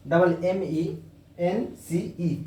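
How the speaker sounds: slow letter by letter; slowly dictating the spelling. Shown as a demonstration. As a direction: spell each letter clearly. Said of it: "इसका spelling सी ओ".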